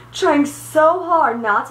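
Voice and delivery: laughing while telling it; drawn out through a real laugh; stifled laughter. Real, not acted. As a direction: break into a laugh mid-sentence.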